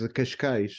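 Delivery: slow "Cascais", "cashkaizh"; Portuguese "Cascais", sh then zh. Shown as a demonstration. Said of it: "the first sh is different from the last".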